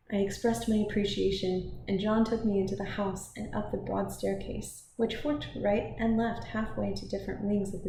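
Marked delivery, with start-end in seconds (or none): none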